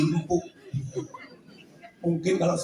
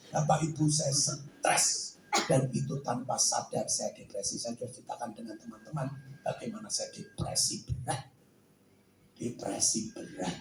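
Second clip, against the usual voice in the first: hushed confession; 10 semitones lower, 8 dB quieter than usual. Real, not acted. Confessing depression to the audience.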